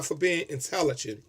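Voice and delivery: normal talking voice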